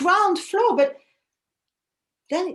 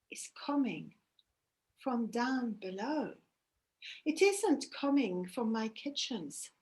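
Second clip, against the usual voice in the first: hushed dread; 6 dB quieter than usual. Acted. Goddess realises with dread.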